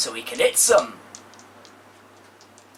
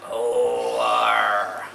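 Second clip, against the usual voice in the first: pirate arr; 3 semitones lower, 3 dB louder than usual. Acted. Pirate growl.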